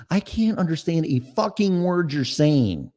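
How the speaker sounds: normal talking voice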